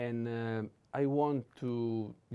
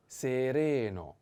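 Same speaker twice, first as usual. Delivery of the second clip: sereno drawn out syllable by syllable; slow model; 2 semitones higher, slower than usual. Shown as a demonstration. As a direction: stretch "sereno" slowly for learners. Teacher models word slowly.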